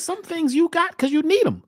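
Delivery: normal talking voice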